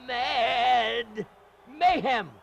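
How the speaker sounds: MAD stretched long and loud, then MAYHEM punched high; dramatic drawn-out emphasis. Real, not acted. Commentator on bloody cage match, "mad mayhem" drawn out and punched.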